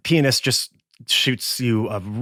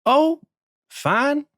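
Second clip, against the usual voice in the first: much higher, evasive squeak; high squeaky bartender. Acted. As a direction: squeak it out, caught in a lie. Nervous bartender.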